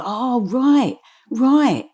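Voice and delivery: imitated moment of discovery; dawning realisation. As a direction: act out the penny dropping.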